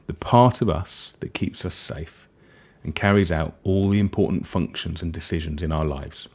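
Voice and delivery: usual speaking voice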